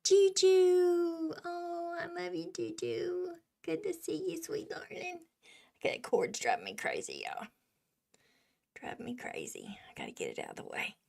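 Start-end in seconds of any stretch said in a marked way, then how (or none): 4.4-10.9 soft, rushed whisper; very fast hushed grumble. Real, not acted. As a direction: mutter it under your breath. Whispered grumble about the cord.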